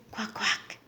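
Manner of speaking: soft whispered duck quack. Shown as a demonstration. Parent imitating duck for toddler.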